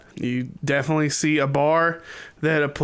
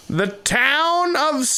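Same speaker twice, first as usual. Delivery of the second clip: high, stretched town-crier build-up; slow, high herald's proclamation; grand announcement; 10 semitones higher, much slower than usual. Acted. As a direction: announce it slowly with a high, drawn-out swell. Game master announces the town.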